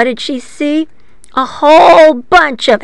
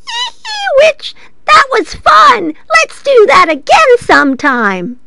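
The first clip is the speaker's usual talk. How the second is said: giggling hat. A narrator's reading. Happy hat giggles to the witch. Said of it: "the hat was smiling too and saying".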